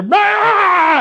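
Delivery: long, very high mock roar; imitated angry roar. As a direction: explode in comic rage. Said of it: "with all my anger".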